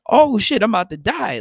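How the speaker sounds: high panicked realization of danger. Retold or imitated. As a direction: blurt it out in sudden fear. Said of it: "I'm like".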